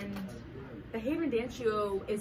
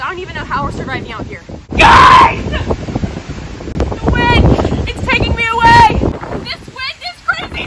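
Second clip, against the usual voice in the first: high, loud panicked yelling; shouting over wind; 13 semitones higher, 20 dB louder than usual. Pretend. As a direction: scream over the storm. Reporter in pretend hurricane wind.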